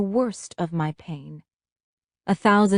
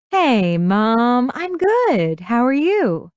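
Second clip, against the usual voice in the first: high, loud, drawn-out phone greeting; forced cheer; 5 semitones higher, 4 dB louder, slower than usual. A narrator's reading. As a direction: put on an everything-is-fine voice. Daughter feigns cheer to mother.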